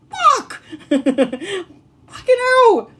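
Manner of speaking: high laughing curse, then slow; laughing disbelief. Real, not acted. Mother laughs in shock at the sign. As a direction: swear through real laughter.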